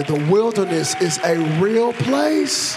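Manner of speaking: loud, slow proclamation; emphatic preaching. Real, not acted. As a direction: drive it home loudly to the congregation. Preacher rallying the congregation.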